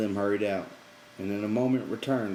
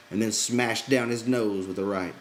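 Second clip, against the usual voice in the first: usual speaking voice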